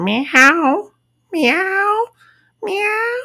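high, repeated cat meow; imitated meowing. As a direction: mimic a coworker's compulsive meows. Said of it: "he's meowing".

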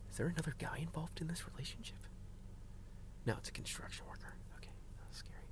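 very soft, quick, half-whispered; hushed spying. Real, not acted. Driver watches stranger at friend's house, hushed commentary. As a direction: keep it under your breath, unheard outside. Secretly watching man nearby.

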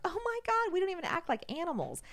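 high, loud exclamation; worked-up acted protest; retold dismay. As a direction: act out being aghast mid-scuffle. Her dismay mid-fight, retold.

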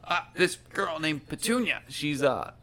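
high, loud goblin guard chatter; excitable guards. Acted. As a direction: bicker like puzzled guards, voice jumping up.

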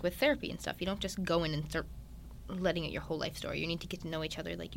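usual speaking voice

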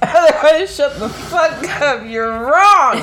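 loud, hammed-up snap of shut the fuck up; mock angry outburst. Joking with her co-host, mock furious at a know-it-all. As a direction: pretend to lose it at someone arguing.

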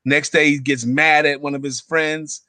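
ordinary talk

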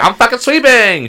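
hammed-up sassy retort; mock shout back. Imitating the sweeping clerk yelling back, sassy.

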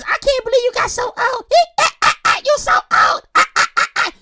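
very high-pitched mock cackling voice; imitating laughing mom. Son imitates mom laughing at his age, high mocking cackle. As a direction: squeal it as mom, cackling between the words. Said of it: "All she can say is".

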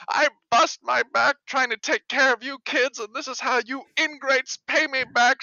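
put-on high shrill scolding old woman; shrill scolding grandma. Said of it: "she's just like".

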